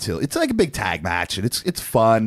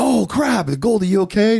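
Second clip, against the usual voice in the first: sudden high alarmed cry; high startled worry over a fallen friend; mock alarmed concern; 11 semitones higher, 4 dB louder than usual. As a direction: act out the crowd's mock alarm, pitched way up. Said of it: "everybody's like".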